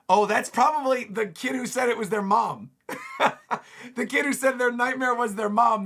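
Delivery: high, loud laughing excitement. Real, not acted. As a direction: crack up at the joke. Laughs at mom monster.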